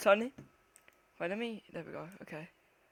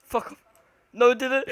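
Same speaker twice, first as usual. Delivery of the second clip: ordinary talk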